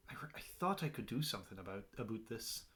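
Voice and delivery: hushed, halting admission; whisper. Acted. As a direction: whisper it unsure of yourself. Amnesiac character's hushed admission.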